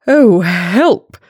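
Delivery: lightly acted fright; mock alarm. A narrator's reading. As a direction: yelp it as a startled fox. Fox is frightened of Gruffalo.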